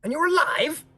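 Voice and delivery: high, incredulous question; can't believe they survived; astonished. Acted. Wizard Alec stunned the player lived.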